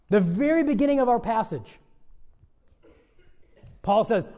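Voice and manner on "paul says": usual speaking voice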